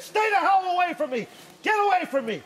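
high, loud warning shout; re-enacted furious yell. Hunter warning off the bear, yelling hard. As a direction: yell to scare off an animal.

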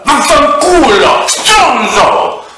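high, loud, slow drawn-out yell; screeching involuntary curse; shouted swear. Pretend. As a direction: stretch the curse out at full volume. Mock tic outbursts of swearing.

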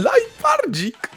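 squeaky drawn-out endearment; adoring squeal. Real, not acted. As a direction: stretch the pet name in delight. Cooing creature's name, first take.

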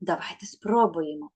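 ordinary delivery

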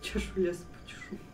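whispering. Real, not acted. Whispered echo of the line.

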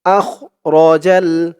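slow, careful Quran chant; slow recitation. Shown as a demonstration. Teacher reciting the next verse slowly. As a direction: recite each phrase slowly. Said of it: "আপনারাও আমার সাথে তেমনভাবে বলবেন".

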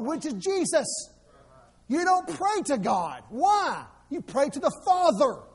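ordinary talk

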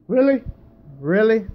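loud, high disbelieving yelp; exasperated outburst. Real, not acted. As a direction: cry out at the unfair miss. Exasperated after repeated misses.

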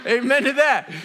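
laughing call for amen. Real, not acted. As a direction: laugh it out with the crowd.

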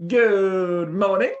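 loud, slow, high, drawn-out greeting; unparroty parrot. A narrator's reading. Parrot waking up to greet his new owner, unparroty. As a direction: boom it out grandly, stretching each word. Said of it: "in a distinctly unparroty voice".

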